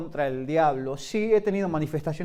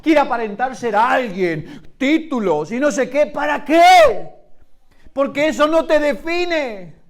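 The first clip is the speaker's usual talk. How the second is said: loud, rising sermon delivery with slow, drawn-out peaks; full-force preaching, stretching the key lines; fiery preaching; 8 semitones higher, 11 dB louder, slower than usual. Acted. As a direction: preach it out to the congregation.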